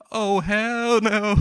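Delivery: high, drawn-out cry of refusal; mock horror. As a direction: stretch it into a slow, hammed-up wail. Acted horror at threat.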